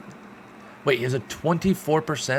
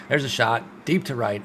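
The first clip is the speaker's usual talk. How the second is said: normal talking voice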